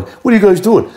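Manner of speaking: high-pitched retold exasperation; mock scolding. Extra wrongly directing the other actors, high mock scolding. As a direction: imitate yourself telling others off, voice jumping up. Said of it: "Here's me going".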